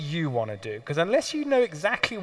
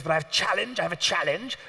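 posh instructor voice; 2 semitones higher than usual. Retold or imitated. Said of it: "speaks with the most outrageous accent".

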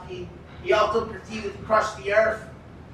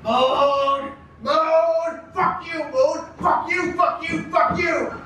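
put-on furious drunk shouting; 6 semitones higher, slower than usual. Bukowski squaring up to fight the moon, furious shouting. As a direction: spit the curses up at the moon. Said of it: "and he says".

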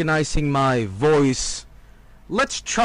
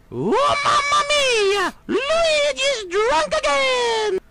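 high, slow Italian cartoon yell; Mario voice; much slower than usual. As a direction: belt it like Mario.

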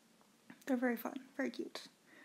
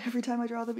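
ordinary delivery

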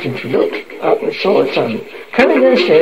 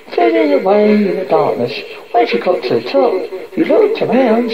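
ordinary delivery, nothing marked

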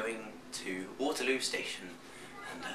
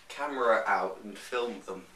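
normal talking voice

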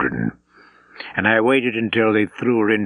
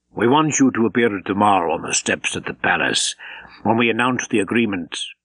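usual speaking voice

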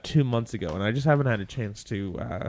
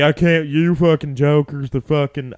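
a light Southern accent, louder, mocking a coworker; 2 semitones higher, 7 dB louder than usual. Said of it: "I don't know why he's a Southern accent".

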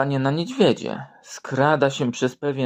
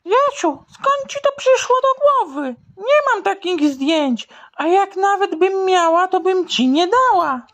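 much higher, indignant teacher; high shocked lady teacher; 4 dB louder than usual. Acted. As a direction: scold the boy in a high prim voice. Teacher scolds Jasiu.

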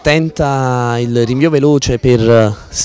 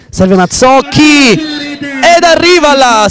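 breathless italian goal commentary; 7 semitones higher than usual. Real, not acted. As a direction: belt out the goal. Goal call, live play-by-play.

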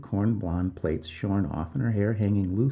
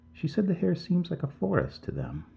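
higher-pitched, hesitant admission; faltering; 9 semitones higher than usual. A narrator's reading. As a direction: carry on uncertainly after faltering. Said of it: "Missus King faltered".

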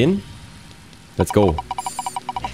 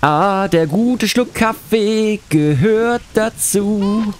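higher, very slow put-on voice; disguised voice; 6 semitones higher, much slower than usual. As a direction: stretch it out in a silly voice. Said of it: "mit verstellter Stimme vor". (verstellte Stimme, langsam und höher)

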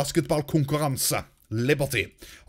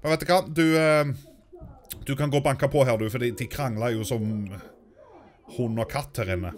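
ordinary talk, nothing marked

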